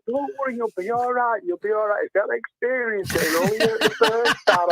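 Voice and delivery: retold women's gentle coaxing; mock reassurance. Women reassuring the crying man.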